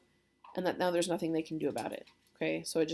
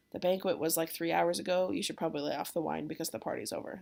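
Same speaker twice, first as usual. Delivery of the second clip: usual speaking voice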